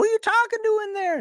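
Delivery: very high, loud call from another room; shrill parent yelling. Retold or imitated. A parent calling in high and loud to a child playing. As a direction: yell through the door at a noisy kid. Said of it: "like you did when you were a kid".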